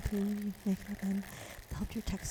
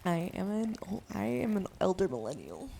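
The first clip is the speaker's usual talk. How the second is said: usual speaking voice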